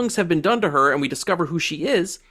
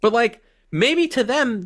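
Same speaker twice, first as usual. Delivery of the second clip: normal talking voice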